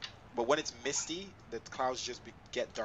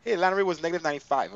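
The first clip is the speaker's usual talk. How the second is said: louder, fast excited call; 4 semitones higher, 9 dB louder, faster than usual. Real, not acted. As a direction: announce it excitedly. Excited landing rate.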